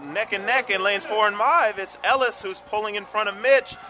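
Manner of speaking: rising, loud live race call; race caller. Real, not acted. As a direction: call the finish with building excitement.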